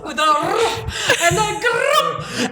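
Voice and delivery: hammed-up, drawn-out growling lion; wobbly, growly singing with huge flourishes; Bert Lahr impression. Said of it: "sounds like Bert Lahr from The Wizard of Oz".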